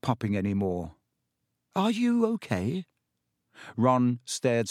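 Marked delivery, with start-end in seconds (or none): 1.7-2.8 high-pitched check on a friend; concerned question. A narrator's reading. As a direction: ask with raised pitch, concerned. Harry checks on stunned friend.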